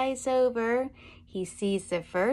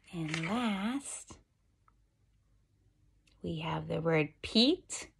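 usual speaking voice

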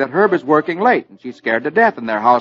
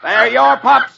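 higher, louder shout back; 9 semitones higher, 3 dB louder, slower than usual. Acted. Herb and Tootsie shouting back from the other window. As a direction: fling it back across the yard. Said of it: "stick their heads out ... and shout".

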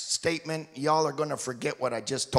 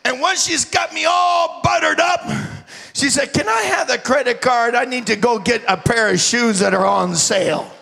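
preaching at full force; 12 semitones higher, 10 dB louder than usual. Real, not acted. Preacher roaring to his congregation, loud and high. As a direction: shout it out to the church.

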